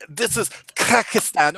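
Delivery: slow, rolling every R; rolled R accent. Retold or imitated. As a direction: roll the R's hard. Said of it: "I was doing the tongue rolls".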